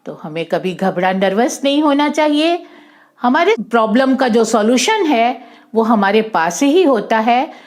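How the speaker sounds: plain reading voice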